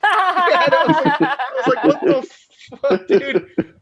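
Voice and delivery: slow, laughing disbelief; helpless laughing outrage. Real, not acted. Laughing reacting to crowd.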